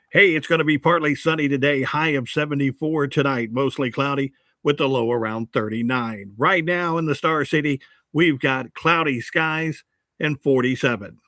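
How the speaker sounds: punchy, polished radio weather read; old-time radio voice. Shown as a demonstration. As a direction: read the forecast like an old-school DJ. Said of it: "read it in that radio voice".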